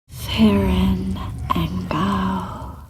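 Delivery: loud, very slow, breath-heavy incantation; spell incantation. Acted. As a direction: cast the spell with long-drawn words. Casting a spell.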